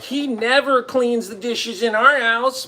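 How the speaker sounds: high, slow, whiny mock complaint; mock heckler wife. Heckler wife complaining about husband, mock whine. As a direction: drag it out like a nagging heckler. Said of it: "And she's like".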